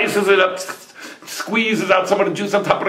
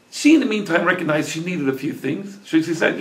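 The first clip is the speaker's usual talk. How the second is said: ordinary delivery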